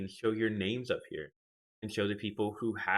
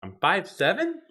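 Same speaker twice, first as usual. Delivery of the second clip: high, loud rising question; incredulous; 12 semitones higher, 7 dB louder than usual. Real, not acted. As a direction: blurt it out in loud disbelief. Reacts to viewer's guess at height.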